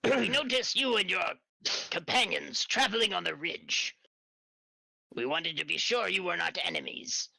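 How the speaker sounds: high put-on creature voice, wary; newt warrior voice. Newt guard explaining to travelers, high wary voice.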